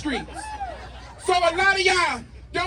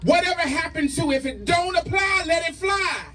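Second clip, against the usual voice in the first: rally speech; 3 semitones higher than usual. Acted. Spoken word rally poem, shouted.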